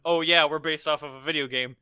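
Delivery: ordinary delivery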